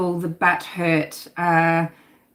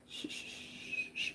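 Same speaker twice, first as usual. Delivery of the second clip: whispered shushing; 23 dB quieter than usual. Retold or imitated. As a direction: hush the baby on a long whispered shh. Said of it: "she's just going".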